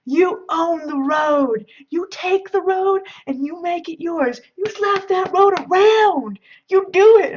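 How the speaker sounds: high, loud, drawn-out imitation of a yelling buddy; mock yelling. Buddy in the back yelling at the driver, mock yelling. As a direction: retell it as a hammed-up motivational yell. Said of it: "he's yelling".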